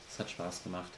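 usual speaking voice

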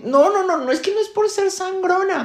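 high-pitched self-justifying whine; whiny protest. Acted. Student defending her fussiness to the teacher, whiny protest.

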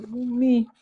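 normal talking voice

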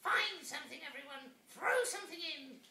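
high witch voice with a grin; high grinning witch instruction. Acted. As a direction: call it out like a happy witch. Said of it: "said with a grin".

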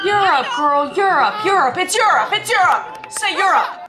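high, loud, insistent correction; exasperated shouting. Real, not acted. Viewer yelling the answer at a quiz contestant, exasperated.

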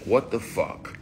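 mildly heated burst of disbelief; spazzing out. Retold or imitated. Man pushing off an intruder in bed, spazzing out. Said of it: "So you spaz out".

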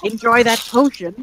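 put-on high old lady voice; knowing send-off from an elderly woman; high-pitched old woman, slipping in a last remark; old lady shopkeeper. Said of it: "burp in the old lady voice".

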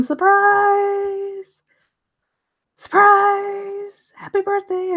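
imitating the birthday crowd yelling surprise; mock party crowd. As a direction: shout it like the guests jumping out. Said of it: "with everybody saying".

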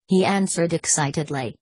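usual speaking voice

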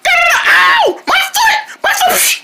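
very high yelps of pain; frantic high ow; pained shriek. Acted. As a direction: squeal as if your foot is burning. Hurt karate chopping the fire.

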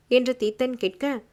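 usual speaking voice

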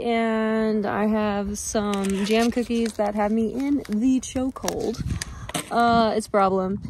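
ordinary delivery

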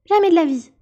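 very loud, high outraged refusal; indignant retort. A narrator's reading. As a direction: fling back an angry refusal. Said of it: "rétorqua la souris en se levant". (refus indigné, fort et aigu)

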